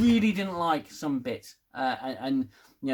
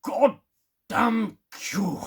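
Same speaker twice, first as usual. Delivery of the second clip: much higher and louder, mock outrage; exasperated Picard yell; 6 semitones higher, 4 dB louder, much slower than usual. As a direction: shout it like a fed-up captain cursing his old nemesis. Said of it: "he goes".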